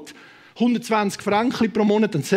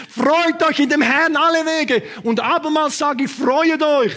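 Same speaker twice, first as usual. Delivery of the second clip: proclaimed scripture; 5 semitones higher than usual. Shown as a demonstration. Preacher rereads the verse to the church, proclaiming. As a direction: proclaim "Freut euch in dem Herrn" slowly from the pulpit. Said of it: "prophetisch, das sind nicht alles prophetisch".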